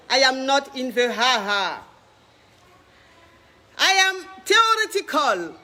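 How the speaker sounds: slow, pompous mock-English declamation; grand self-introduction. Coach grandly introducing himself to his viewers, slow and pompous. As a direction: proclaim yourself like a self-crowned guru, slowly.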